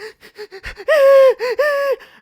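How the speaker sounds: loud, very high squealing sobs; mock crying. Boy bursting into tears before the teacher, mock sobbing. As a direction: heave out fake bawling. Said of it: "I started crying".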